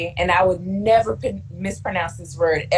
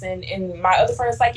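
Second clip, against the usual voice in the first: normal talking voice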